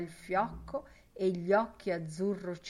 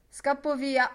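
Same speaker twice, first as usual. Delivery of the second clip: louder, higher impatient burst; hurried; 7 semitones higher, 8 dB louder than usual. Acted. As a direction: blurt it out, in a rush. Boylan hurrying off.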